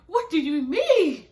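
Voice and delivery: high, very loud, drawn-out question; indignant retold shout; outraged outburst. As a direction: yell it in exasperated disbelief. Retelling her outrage at his reply.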